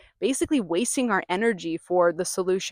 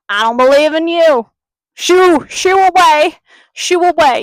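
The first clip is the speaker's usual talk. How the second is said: much higher, much louder mock brush-off; dismissive; 8 semitones higher, 15 dB louder than usual. Voicing the controlling self sending limitlessness away, in a loud mock shoo. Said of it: "you're basically telling, like, limitlessness".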